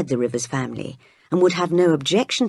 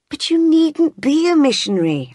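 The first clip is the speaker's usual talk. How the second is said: much higher and louder protest; cried out; 11 semitones higher, 5 dB louder than usual. Acted. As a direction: burst out to stop him. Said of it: "I cried".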